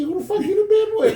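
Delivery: high, laughing outburst; shocked laughing exclaim; mock disbelief. Teller reacting to his old teacher, high laughing disbelief.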